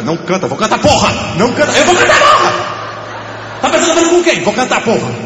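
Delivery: high laughing mock tantrum; mock angry outburst. Comedian mocking the annoying friend with a laughing tantrum. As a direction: fake fury at the heckler, cracking up. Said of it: "ele vai te cutucar".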